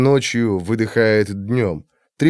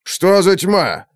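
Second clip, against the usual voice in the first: growl; 6 semitones higher, slower than usual. A narrator's reading. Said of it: "рыкнул Блэкмор".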